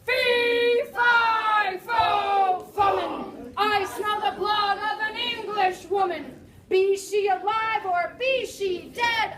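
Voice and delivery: loud, slow booming giant chant; slow loud giant roar; terrifying giant. Acted. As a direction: boom it out like a menacing giant.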